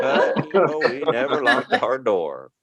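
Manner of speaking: high, slow proud grandma voice; grandma boasting about the old days. Retold or imitated. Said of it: "grandma answering".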